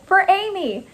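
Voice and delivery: high, called-out battle cry; high-pitched, fervent cheer; rallying cry. A narrator's reading. Rallying cry while throwing plate.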